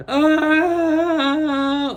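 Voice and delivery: long, loud, high held "uh"; drawn-out wail. Real, not acted. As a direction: hold one high note loudly. Loud drawn-out sound before next item.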